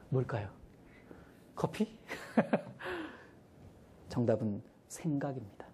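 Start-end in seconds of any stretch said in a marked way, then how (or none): 2.1-3.1 high giggle; very high short laugh. Real, not acted. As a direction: giggle at your own guess. Laughs after joke answer.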